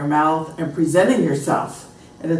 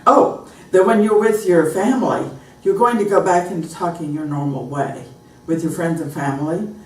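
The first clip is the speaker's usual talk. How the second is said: ordinary talk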